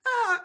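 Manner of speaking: sudden loud high yelp, imitated; high yelp of pain. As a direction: reenact a startled cry of pain. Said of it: "He made that, he goes".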